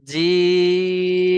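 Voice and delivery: জিম held out for three counts; long drawn jim. Shown as a demonstration. Teacher drawing out জিম for the students. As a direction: stretch the vowel of জিম long and steady. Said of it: "তিন আলিফ পরিমাণ টান দিতে হবে".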